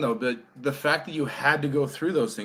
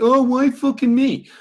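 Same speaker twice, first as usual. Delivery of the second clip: self-pitying whine; 9 semitones higher, 6 dB louder than usual. Retold or imitated. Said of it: "the times where you're like".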